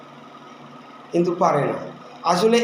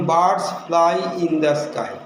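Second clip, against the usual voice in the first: each word spaced out, very slowly; slow dictation; much slower than usual. Shown as a demonstration. Teacher dictating an example sentence to students, slow and deliberate. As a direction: read it slowly for the class to copy. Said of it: "যেমন".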